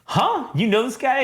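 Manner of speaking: high-pitched mock surprise; acted questioning. Mother asks about the dinner guest, acted questioning.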